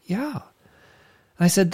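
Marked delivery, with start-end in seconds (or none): 0.1-0.4 put-on much softer, higher child's yes; a timid small-girl yes; little girl's yeah. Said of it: "And she said".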